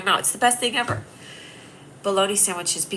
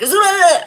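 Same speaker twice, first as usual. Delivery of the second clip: a sudden high, loud "ugh" as the bug lunges; startled disgusted shriek; 11 semitones higher, 10 dB louder than usual. Retold or imitated. Describing the fanged bug to viewers, a loud high startled ugh. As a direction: recoil with a loud high yelp.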